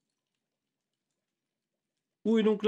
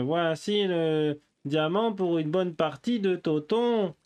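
usual speaking voice

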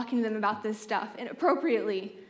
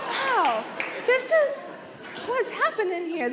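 stunned awkward wow; 9 semitones higher than usual. Retold or imitated. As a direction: voice jumps way up in disbelief. Stunned while friend sobs on her.

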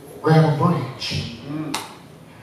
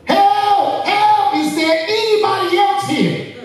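yelling for help. Acted. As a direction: shout again, refusing God's offer. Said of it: "yelled out".

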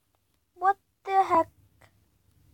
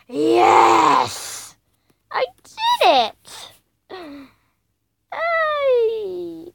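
loud excited cheer; 6 dB louder, much slower than usual. Real, not acted. Gamer cheers after clearing a level, excited. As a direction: shout with the win. Success in game.

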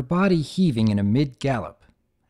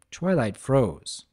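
ordinary delivery

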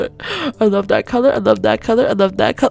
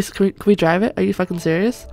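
ordinary talk, nothing marked

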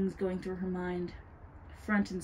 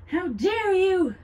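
slow, high, furious shriek; outraged scream; 13 semitones higher, 11 dB louder, slower than usual. A narrator's reading. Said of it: "she screamed".

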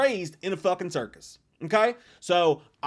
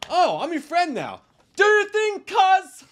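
high, loud mocking whine of the line; mocking dumb voice; 12 semitones higher, 7 dB louder than usual. Mocking the movie's dumbest line in a high loud voice. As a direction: ham up the stupid line, pitched way up and shouted. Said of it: "how stupid are you to just be like".

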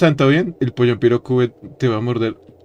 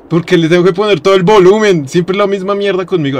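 stressed complaint; 6 semitones higher, 5 dB louder than usual. Real, not acted. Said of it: "tengo el volumen a cien, entonces me pongo".

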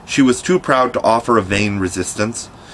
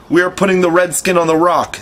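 higher, carrying call across the water; 8 semitones higher than usual. A narrator's reading. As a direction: Smee hails his captain over the lagoon. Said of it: "Smee called out".

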